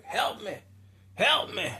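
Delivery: high, loud cry for help; fallen man pleading. Retold or imitated. Storyteller voices a fallen man yelling for help, pleading. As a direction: call out for rescue from the ground.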